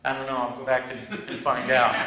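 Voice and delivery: low, quiet, deflated admission; a lightly voiced sheepish speed reader; sheepish partner. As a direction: mumble it, caught out. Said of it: "you know what she says".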